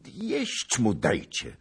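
high, loud, drawn-out order; shouted. A narrator's reading. King ordering food for the starved knight, shouted. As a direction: call out urgently for food. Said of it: "krzyknął król".

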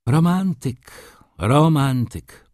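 amused, shaking his head at him; with a smile. A narrator's reading. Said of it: "powtarzał adwokat z uśmiechem". (z uśmiechem, wyżej i głośniej)

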